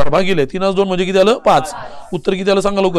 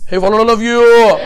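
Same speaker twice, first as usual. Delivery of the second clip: higher, slow call with a long-held final "you"; drawn-out call; 4 semitones higher, 4 dB louder, much slower than usual. Real, not acted. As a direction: stretch it out to rouse the whole class. Repeats check, calling to class. (लांबवलेली, उंच आवाजातली हाक)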